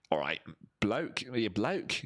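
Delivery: lightly put-on English bloke greeting; a hint of an English accent, rising at the end; mock English accent. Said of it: "English people say blokes".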